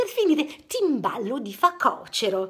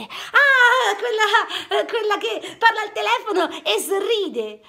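laughing; 7 semitones higher, 3 dB louder than usual. Acted. Amused realization.

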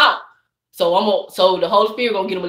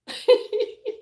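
a high, delighted burst of giggling; high giggle; 13 semitones higher, 6 dB quieter than usual. Real, not acted. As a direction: giggle at your own joke. Tickled by her own story.

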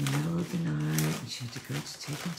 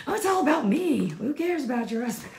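high, loud, hammed-up self-centred whine; selfish jerk; 11 semitones higher, 10 dB louder than usual. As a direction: play the jerk who only cares about himself.

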